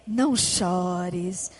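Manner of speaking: very soft, very slow and low; tender comfort. Acted. Jesus comforting the grieving widow, soft and tender. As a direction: hush her tears gently, almost breathed. Congregation prompted to repeat comfort.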